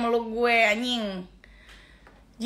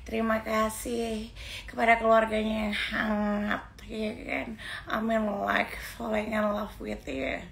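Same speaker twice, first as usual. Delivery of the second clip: mock calm gushing; 8 dB quieter than usual. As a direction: parody her sweet calm thank-you speech. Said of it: "waktu kalem". (tiruan suara kalem dan manis, jauh lebih pelan)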